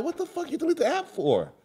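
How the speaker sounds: can't believe she deleted it; shocked squeal of a question; outraged disbelief. Retold or imitated. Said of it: "I'm like".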